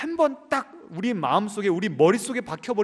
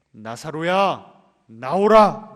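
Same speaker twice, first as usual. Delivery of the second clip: slow then loud 나오라; commanding call; 9 dB louder, much slower than usual. Acted. Jesus at the tomb commanding Lazarus to come out, loud.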